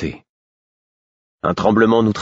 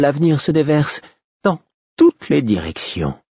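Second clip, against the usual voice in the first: prophetic trance voice; 7 semitones higher than usual. A narrator's reading. Fool sees the future.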